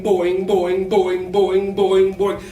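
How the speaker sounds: loud, springy boing boing for each hop; playful loud kangaroo boings; bouncy boing sounds. Shown as a demonstration. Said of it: "Go boing".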